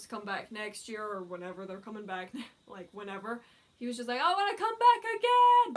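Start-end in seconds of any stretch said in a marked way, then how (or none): 4.2-5.7 high, loud excited call; eager idol. Retold or imitated. Idol promising fans he'll return, high excited shout.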